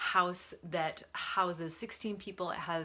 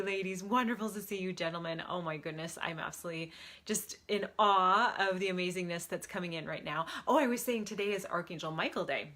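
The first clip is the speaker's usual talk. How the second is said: ordinary talk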